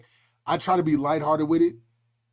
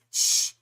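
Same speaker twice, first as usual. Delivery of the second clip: playful "shh" to an accomplice; drawn-out conspiratorial shushing, acting out a skit; mock hushing. Skit hushing his son.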